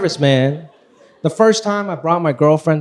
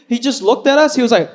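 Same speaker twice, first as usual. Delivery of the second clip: normal talking voice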